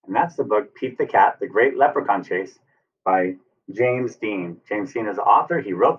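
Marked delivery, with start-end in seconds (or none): none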